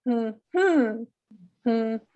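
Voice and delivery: short hum, then longer rising-falling hum; unstressed-stressed hum. Shown as a demonstration. Teacher showing third column, hum stressed on second beat. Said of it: "let's try that one together".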